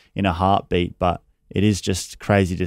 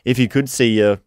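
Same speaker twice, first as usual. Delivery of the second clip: choked up; 4 semitones higher, 4 dB louder than usual. Real, not acted. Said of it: "but it's a bit hard with this sometimes".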